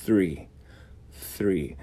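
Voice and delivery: "Three" with blown th. Shown as a demonstration. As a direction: blow air between teeth on "Three". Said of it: "pôr a língua entre os dentes e soprar".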